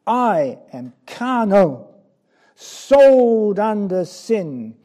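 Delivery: slow, loud anguished confession; bitter anguish. Acted. Preacher voicing Paul in bitterness and despair, slow and loud. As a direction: drag the words out in despair. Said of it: "in the bitterness of his soul anguish and despair".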